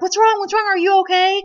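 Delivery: high, loud, urgent questioning; retold panicky check-in; alarmed concern. Husband reacts to her freak-out.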